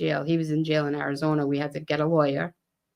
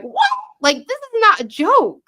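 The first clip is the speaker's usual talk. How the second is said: much higher and louder disbelief; shocked outburst; 7 dB louder than usual. Retold or imitated. Sister hearing her brother's heroin secret, shocked outcry. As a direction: cry out in shock.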